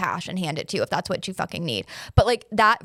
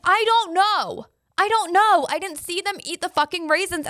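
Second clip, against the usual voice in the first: stressed panic; 8 semitones higher than usual. Retold or imitated. Answering the vet about the raisins, retold high, stressed panic. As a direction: let the stress crack through, much higher. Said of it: "and I'm stressed".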